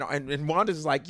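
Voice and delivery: ordinary talk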